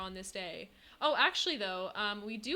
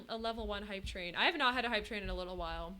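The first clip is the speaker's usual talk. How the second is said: normal talking voice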